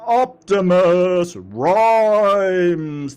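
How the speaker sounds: slow, drawn-out announcer name call. Pretend. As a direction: stretch both words out big. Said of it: "announcer voice going".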